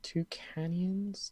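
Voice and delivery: slow whispered Toucanians?; whispered try-out. Shown as a demonstration. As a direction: whisper it slowly, testing the name. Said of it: "it's a bit of a mouthful".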